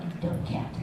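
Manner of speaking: breathy muttered aside at the cat; muttered insult. Retold or imitated. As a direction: mutter it under your breath. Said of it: "I'm like".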